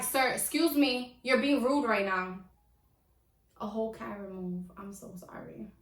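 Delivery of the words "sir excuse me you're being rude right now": loud and annoyed. Retold or imitated. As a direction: call him out for being rude. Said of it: "So I start getting louder at this point".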